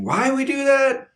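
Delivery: puzzled. Acted. Mock puzzlement at the exception.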